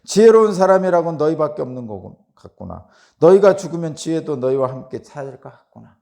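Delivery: loud, indignant retort; acted anger. Preacher voicing Job retorting to his friends, loud anger. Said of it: "욥은 뭐라고 화를 내요?".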